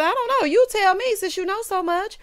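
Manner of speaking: high, loud imitated retort; retold snippy comeback. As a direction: throw it back high and challenging. Acts out sarcastic comeback.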